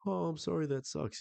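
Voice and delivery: high-pitched mock sympathy; sarcastic. Woman brushing off the pestering guy, sarcastic. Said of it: "she was being sarcastic here".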